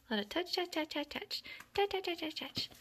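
high, bouncy string of touches; playful patter. Real, not acted. Getting kittens used to touches, high playful patter.

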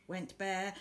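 ordinary delivery

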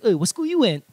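lightly acted pidgin pickup question; a touch of corny Hawaiian pidgin; casual pidgin come-on; corny pidgin line. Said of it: "it's always corny".

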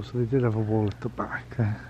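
usual speaking voice

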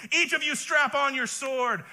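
rally the men to arms; David's command. Acted.

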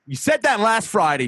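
high sharp New York landlord retort; hammed-up New York accent comeback. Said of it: "New York accent".

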